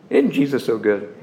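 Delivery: ordinary delivery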